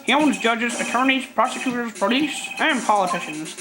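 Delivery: lightly voiced troubled old lawyer; a hint of a weary elderly gentleman; old man voice. Old attorney character voiced.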